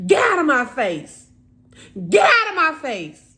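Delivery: loud, high angry yell imitating a mother; furious retold outburst, full volume; yelling, screaming mother. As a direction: scream at a child to leave. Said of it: "yelling and screaming".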